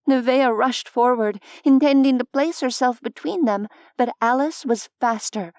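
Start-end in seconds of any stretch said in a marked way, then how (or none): none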